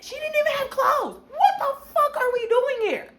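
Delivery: high, loud, fast disbelieving yell with a laugh; panicked yelling. Retold or imitated. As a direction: yell it like everything's falling apart at once. Retelling panic at customs.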